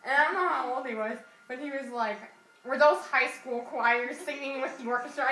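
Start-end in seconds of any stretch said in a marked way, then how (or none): 2.6-5.2 lightly imitated earnest little kid, louder; a touch of Poindexter, pushed louder; funny kid voice. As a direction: mimic a nerdy kid's funny voice. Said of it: "this is how this kid talked".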